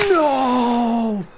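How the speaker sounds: loud high cry of dismay; horrified no. Real, not acted. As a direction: cry out in horror. Shocked after shooting teammate.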